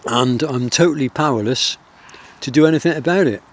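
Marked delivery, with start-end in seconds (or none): none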